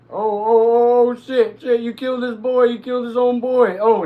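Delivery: high, loud shocked yelling; excited shouting. Real, not acted. Streamer shocked by a kill in the game, excited yelling. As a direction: react in disbelief at full volume. Teammate kills hostage's boy in game.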